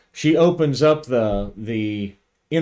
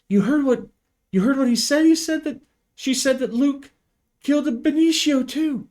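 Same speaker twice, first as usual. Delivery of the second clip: high, pressing, alarmed; urgent; faster than usual. Acted. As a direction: push the others to act now. Owen urging after radio news.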